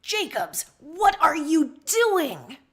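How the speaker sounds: loud, slow furious demand; angry demand. Acted. Captain confronting Jacobs at the brig, loud and angry. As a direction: bellow at the junior officer. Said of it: "You demand as you march".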